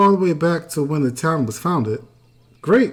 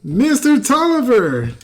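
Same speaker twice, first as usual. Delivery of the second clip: drawn-out, higher call on "Mister Tolliver"; raised, slow call to rouse someone; insistent call; 6 semitones higher than usual. Acted. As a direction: demand his attention, stretching the name and falling at the end. Slams table, repeats call to rouse him.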